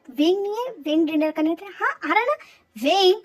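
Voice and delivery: high, loud call across the house; mock call from another room. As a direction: shout the name to fetch him. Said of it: "उसको आवाज़ देता".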